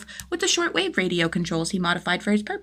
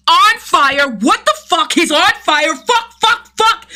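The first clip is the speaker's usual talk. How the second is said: loud, high panicked yelling; shocked shouting; 10 semitones higher, 8 dB louder, slower than usual. A narrator's reading. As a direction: yell in pain and shock as flames hit. Jason set on fire, shouting.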